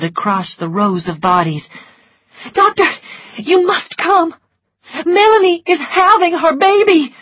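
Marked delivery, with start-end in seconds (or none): 2.5-7.1 high, loud urgent plea; pleading Scarlett. A narrator's reading. Scarlett begging the doctor, high and urgent. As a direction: insist he must come.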